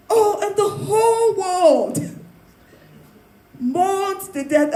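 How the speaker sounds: slow, high, overdramatic lament; sarcastic imitation of public grief; mock mourning. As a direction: draw it out in hammed-up grief. Mocks public mourning of queen.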